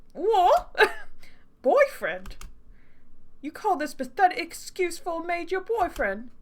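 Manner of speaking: incredulous, high fake British accent; hammed-up British accent, outraged; bad British accent. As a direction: scoff in a dodgy British accent. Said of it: "he deserves a really bad accent".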